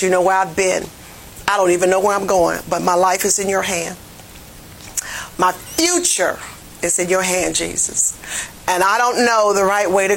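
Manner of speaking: low, hushed, earnest prayer voice. Acted. Preacher modelling a confession to the Lord, low prayer.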